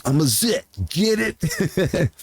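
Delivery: mock Belushi, pleased with himself; imitating Belushi. Man retelling Animal House cafeteria joke, hammed-up Belushi imitation. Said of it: "And he goes".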